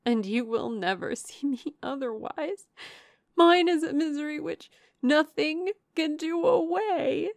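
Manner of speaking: high, loud, tearful lament; tearful despair. A narrator's reading. Miserable sister refusing all consolation, tearful despair. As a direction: build from bitter protest into a wail.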